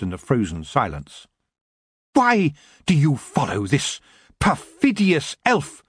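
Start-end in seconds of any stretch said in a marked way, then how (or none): 2.1-5.8 high, slow regal challenge; queenly demand. A narrator's reading. The queen confronting her elves, demanding. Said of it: "the queen demanded of the elves".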